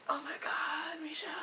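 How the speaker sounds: breathless gasping whisper; gasp, then whisper it in awe; retold stunned whisper after a gasp; shocked whisper. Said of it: "and I'm kinda like".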